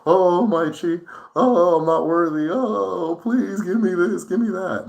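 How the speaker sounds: high, pleading mock-groveling voice; begging servile prayer. Shown as a demonstration. Mocking someone who begs their chi, high and whiny. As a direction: grovel and beg like an unworthy servant. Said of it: "It's not".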